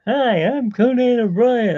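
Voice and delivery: high, loud talk-show host voice; Conan O'Brien. Retold or imitated. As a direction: greet like a late-night host. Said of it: "I'm doing a Conan O'Brien".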